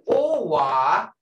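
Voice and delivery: "owa" drawn out slowly with a rise; slow long vowel in "owa". Shown as a demonstration. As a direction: stretch "owa" long and lift it. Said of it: "There's a short way to owa and owa".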